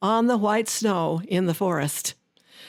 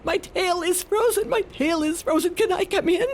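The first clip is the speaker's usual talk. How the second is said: higher, whining fox pleading; frozen fox; 8 semitones higher than usual. Acted. Fox begs to come into the mitten, higher and whining. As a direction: cry out about a frozen tail. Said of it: "And he said".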